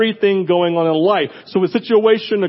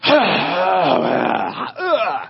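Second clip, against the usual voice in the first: more exasperated sigh from another room; 4 semitones lower than usual. Real, not acted. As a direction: heave a big annoyed sigh offstage. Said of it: "with even more exasperated tone".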